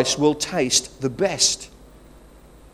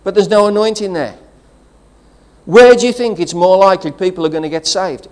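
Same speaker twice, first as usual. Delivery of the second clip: louder, higher, driving preacher's build; preaching at full force; 5 semitones higher, 6 dB louder than usual. Acted. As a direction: preach it out to the whole congregation.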